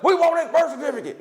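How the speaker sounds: high-pitched mock complaint; mock protest. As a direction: squeal it like an indignant objector. Acted outcry of other teams' parents.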